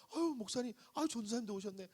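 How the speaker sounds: hospital patient suddenly thrilled to see pastor; gushing welcome. Retold or imitated. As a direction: greet the guests with sudden joy. Deacon now warmly welcomes visitors.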